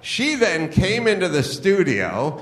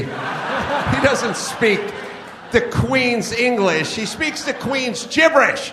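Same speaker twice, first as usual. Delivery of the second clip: mocking; 7 semitones higher than usual. Real, not acted. Mocking the English accent.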